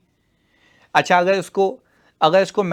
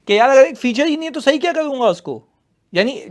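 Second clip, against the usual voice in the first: mock exasperated client; 8 semitones higher, 5 dB louder than usual. Teacher acting out the client.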